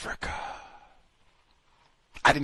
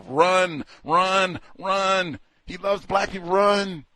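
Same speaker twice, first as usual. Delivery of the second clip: higher, louder frantic warning; mock panicked run; 8 semitones higher, 4 dB louder, slower than usual. Vlogger warning listeners to flee, mock panic. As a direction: yell it laughing like fleeing.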